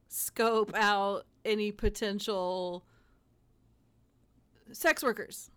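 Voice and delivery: ordinary talk, nothing marked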